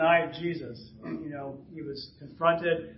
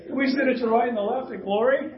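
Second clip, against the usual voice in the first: raised, louder acted petition; pleading request; 6 semitones higher, 5 dB louder than usual. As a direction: ask eagerly, pitched up high. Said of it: "mom helped them ask the question".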